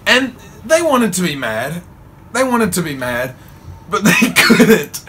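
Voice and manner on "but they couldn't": higher, louder, slow laughing words; real laughter. Real, not acted. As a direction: crack up mid-sentence. Laughing about parents' reaction.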